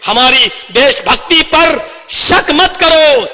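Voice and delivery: impassioned rally speech with ringing emphasis; fiery oratory. Real, not acted. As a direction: address the crowd like a fiery orator. Impassioned political speech to a crowd.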